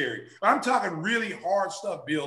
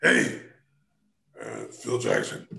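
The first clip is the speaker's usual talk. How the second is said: lower, slow, unhurried intro; low laid-back coach; 9 semitones lower, slower than usual. Retold or imitated. New coach introducing himself to the player, low and slow. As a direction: say your name cool and slow.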